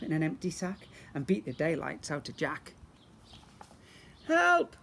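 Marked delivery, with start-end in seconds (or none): 4.3-4.8 very high, loud shriek of help; shout for help. Acted. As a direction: cry out in panic. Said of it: "shouted Billy".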